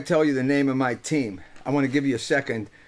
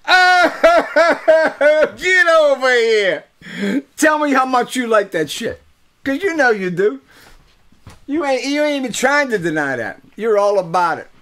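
loud, high gleeful crowing at listeners; loud gloating; 9 semitones higher, 7 dB louder than usual. Pretend. Crowing to listeners about his team name, loud and gleeful. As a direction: rub it in, laughing and shouting.

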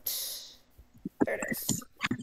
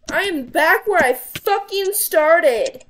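loud, slow exasperated groan; frustrated; 4 semitones higher, 9 dB louder, much slower than usual. Real, not acted. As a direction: drag it out, fed up. Frustrated game setback.

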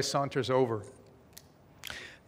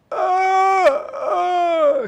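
choking gasps; 10 dB louder than usual. Acted. Choking woman gasping.

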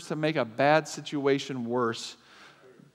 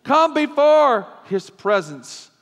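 emphatic psalm; 8 semitones higher, 7 dB louder, slower than usual. Acted.

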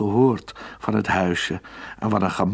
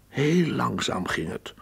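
plain reading voice

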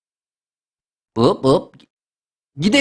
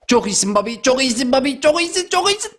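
building self-encouragement. Real, not acted. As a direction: pitch climbing with each repeat. Cheers himself on near the finish.

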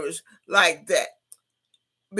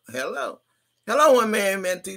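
usual speaking voice

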